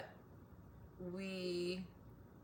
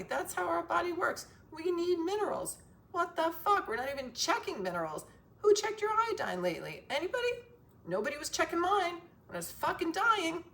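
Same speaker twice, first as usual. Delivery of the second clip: angry rant; 11 semitones higher, 4 dB louder than usual. Real, not acted.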